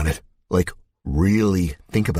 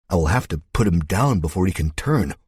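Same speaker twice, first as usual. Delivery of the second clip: ordinary delivery, nothing marked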